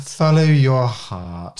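slow, drawn-out follow your heart; slow, sing-song cheesy motto; mock slogan. Repeating the slogan slowly.

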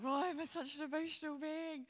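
laughing at herself through tears; real crying half-laugh; teary self-mockery. Real, not acted. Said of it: "I'm annoyed that I'm crying".

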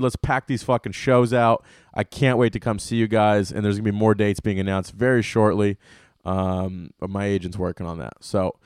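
ordinary talk, nothing marked

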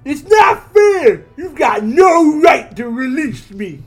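high, loud, slow outraged yell; sputtering foiled villain. Acted. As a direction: shriek after him, fist shaking. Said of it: "Edwin's calling out to Dredd".

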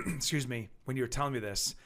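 normal talking voice

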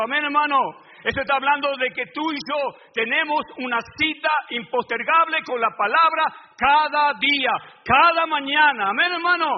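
loud, driving preacher's cadence; fiery preaching. Acted. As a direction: preach it to the congregation with fire. Preacher at full force again.

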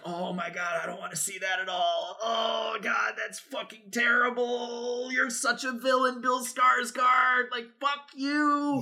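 hammed-up horror, stretching the words out; mock anguished disgust. Acts out horrified reaction to film moment.

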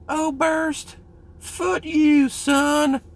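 very high, loud mimicking falsetto. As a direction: exclaim it in a drawn-out high-pitched impression. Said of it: "Had a little bit of a high voice".

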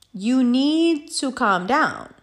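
very slow, each word stretched; deliberate, drawn-out retold command; firm warning. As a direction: spell it out slowly to someone out of control. Repeats warning slowly, deliberately.

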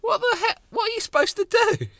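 shocked disbelief; outraged surprise. Real, not acted. Outraged at the NHS.

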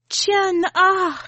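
high loud cry of the name; near shriek. A narrator's reading. Grandmother bursting into the room, near-shrieking the grandchild's name. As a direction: call out the name, sharp and high. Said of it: "she almost shrieks".

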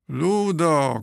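high, loud call of a name; shouting together. A narrator's reading. Flock of cormorants shouting for Ludo, loud and alarmed. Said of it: "krzyczały jeden przez drugiego".

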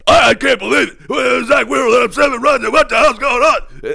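mock hysterical pundit shout; mocking panicked pundits. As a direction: ham up the doom of sports radio hosts. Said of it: "Michael Barkann and Ricky Bottalico, the fucking sky is falling".

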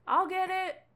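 bright high call that jumps in to grab the phone; Hildy chiming in. Acted. Hildy offers to answer the ringing phone, high eager call. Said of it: "and Hildy's like".

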